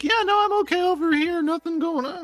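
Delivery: high innocent voice. Retold or imitated. Playing Vernon, nothing's wrong.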